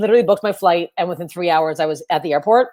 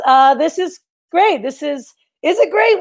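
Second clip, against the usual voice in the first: sarcastic; 8 semitones higher than usual. Real, not acted. Sarcastic about doing comedy.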